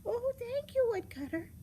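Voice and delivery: put-on high, gushing girl; high-pitched relieved gratitude. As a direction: thank sweetly in a high child's voice. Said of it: "was so thankful".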